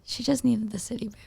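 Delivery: very soft whisper; hushed, voiceless little aside. Real, not acted. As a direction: whisper a correction to your partner.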